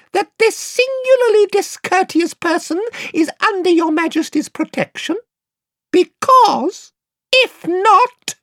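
very high, stiff, affronted piping; piping offended mouse. Acted. Reepicheep to Lucy about the rude Eustace, piping. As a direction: pipe it with offended dignity.

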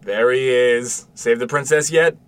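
put-on loud, drawn-out hello to the kid; father calling out to his son; cheerful greeting. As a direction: greet him brightly as he comes in.

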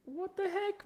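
very high, soft squeak of disbelief; high-pitched, quiet mock protest. Mock reaction to coworkers' doubt.

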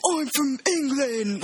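slow, high, exaggerated British accent; mock British accent. Shown as a demonstration. Showing what her British accent isn't or is, mock British. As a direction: ham up a drawn-out British accent. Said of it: "pretty British accent. It's like".

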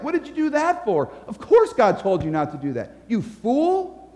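mock-accusing, pitch jumping up on each jab; accusing devil. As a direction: pile on the blame as the devil. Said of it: "the devil comes along and he's like".

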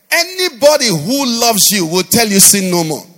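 loud sung-preached climax; forceful preaching peak. Real, not acted. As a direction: drive it home to the church. Preacher climaxes, loud over music.

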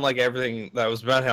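ordinary talk, nothing marked

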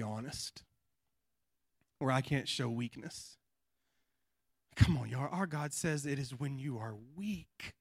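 soft intimate preaching. Acted.

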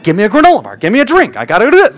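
high, loud mock-desperate demands; frantic demanding. Dad grabbing at his wife on the road trip, frantic demanding. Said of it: "I just start grabbing her".

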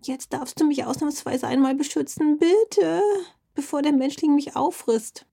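high, pleading little fox voice, rising on bitte; frightened pleading. A narrator's reading. Frightened fox begging Dackel to protect her, pleading. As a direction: beg for protection in a small scared voice. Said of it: "heulte die Füchsin".

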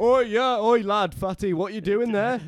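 high, loud mocking street yob; impression of a lad. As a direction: bark it at him like a lad picking a fight. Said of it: "do you want me to do the impression?".

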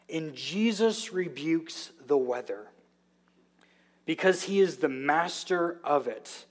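ordinary delivery

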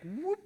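quick rising whoop; imitated swing whoop. Golfer mimics his one-handed swing, whoop.

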